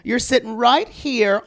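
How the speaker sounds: normal talking voice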